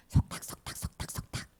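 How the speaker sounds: very soft, voiceless whisper; imitated whispering. Satan murmuring worry and anxiety to children, imitated whisper. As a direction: whisper it like secret chatter in an ear. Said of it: "사탄이 귀에다가 속삭일".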